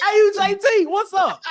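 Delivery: high, loud hype call; shouted greeting. Shown as a demonstration. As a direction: belt it out like greeting your boy. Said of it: "That's how you say it".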